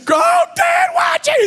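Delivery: high, loud mock wail of a hurt boy; hammed-up crying boy yelling at dad; crying kid. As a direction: imitate a crying son, shouting high. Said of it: "He's crying".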